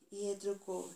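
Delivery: plain reading voice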